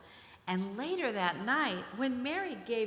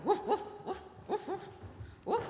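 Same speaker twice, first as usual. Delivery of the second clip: much higher playful barks; bright mock puppy woofs; barking dog; 9 semitones higher than usual. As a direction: bark like an excited puppy. Narrator voices the dog.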